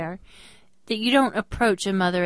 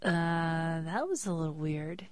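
much softer, uneasy clerk aside; soft uneasy murmur; 3 semitones lower, 8 dB quieter than usual. Retold or imitated. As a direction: say it low and a bit unsettled. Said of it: "and she goes".

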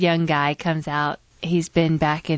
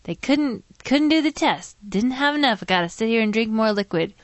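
put-on young guy quote; 7 semitones higher than usual. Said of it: "He goes".

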